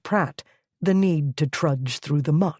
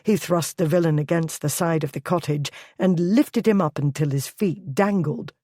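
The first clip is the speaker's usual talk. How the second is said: usual speaking voice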